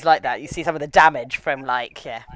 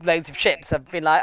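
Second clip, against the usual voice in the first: ordinary talk, nothing marked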